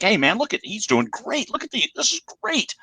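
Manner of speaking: high, impressed, pitched right up; lightly played thrilled praise; mock delighted. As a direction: gush like a happy listener. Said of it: "I'm like".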